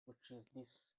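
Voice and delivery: usual speaking voice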